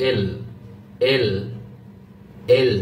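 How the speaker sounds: "এল" with the tongue at the upper tooth ridge; touch the tongue behind the upper teeth for "এল"; careful L. Shown as a demonstration. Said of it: "এখানে আপনি লাগাতে পারেন". (জিহ্বা উপরের দাঁতের গোড়ায় "এল")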